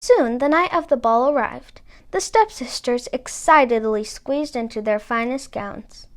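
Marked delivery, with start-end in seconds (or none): none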